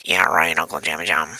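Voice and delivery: low, dismissive imitation; imitating a deadpan skeptic; unimpressed. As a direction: drop the pitch, totally unimpressed. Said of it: "Rosie's not really impressed".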